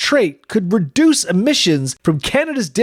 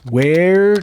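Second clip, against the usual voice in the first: dreamy slow; 4 semitones lower, much slower than usual. Acted. Dreamy about candy.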